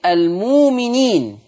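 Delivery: المؤمنين slow, almūminīn with long u, no hamza; slow chant of المؤمنين; hamza turned to waw. Shown as a demonstration. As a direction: recite المؤمنين without the hamza. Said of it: "هتبدل بواو مادية فتصير".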